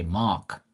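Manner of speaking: "Mark" said without the R. Shown as a demonstration. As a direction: say "Mark" as "Mahk", Bermudian style. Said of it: "instead of the R".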